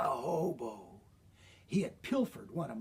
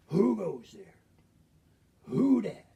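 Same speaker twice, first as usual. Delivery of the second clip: hobo's sharp challenge to an unseen intruder; startled hobo; 4 semitones higher, 6 dB louder than usual. Acted. Startled hobo challenges hidden apple thrower.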